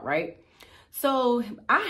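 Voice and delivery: usual speaking voice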